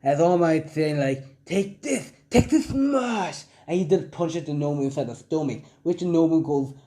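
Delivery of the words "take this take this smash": high-pitched, drawn-out attack call; hero's finishing-move cry, lightly acted; mock battle cry. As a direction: half-shout it like a hero landing a punch. Hero's attack cry in retold fight.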